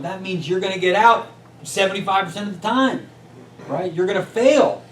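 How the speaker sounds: loud, high, driving the point home; emphatic shout. Real, not acted. Coach rallying business owners, loud and high. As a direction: push it out hard to a room of owners. Pushing the point about failure.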